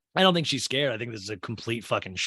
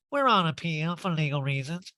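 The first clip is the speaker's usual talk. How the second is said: higher put-on legal talk; mock official answer, pitched up; Erika; 4 semitones higher than usual. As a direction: quote it in an affected voice. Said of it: "Erica just speaks in law talk now".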